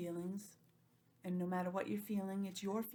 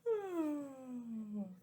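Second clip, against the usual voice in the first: long high yawning sigh; tired yawn; 8 semitones higher than usual. Acted. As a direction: yawn it out sleepily. Teacher acting out tired yawn.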